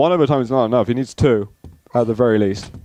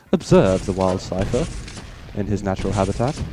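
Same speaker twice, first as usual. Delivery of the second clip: hushed wildlife narrator voice; nature documentary narrator; 4 semitones lower, 3 dB quieter than usual. Pretend. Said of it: "this is like a nature program or something".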